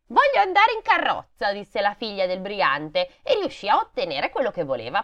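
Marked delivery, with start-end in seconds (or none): 0.1-1.5 put-on high-pitched child's demand; spoilt, stubborn girl. A narrator's reading. Brigand's daughter demanding the carriage ride, spoilt and stubborn. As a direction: whine for it like a spoilt brat. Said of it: "era molto ostinata e viziata".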